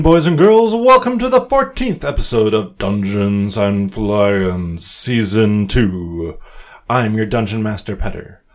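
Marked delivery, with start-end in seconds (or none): 0.0-6.4 grand entrance announcement. Acted. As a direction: announce it like a ringmaster. DM opens the show grandly.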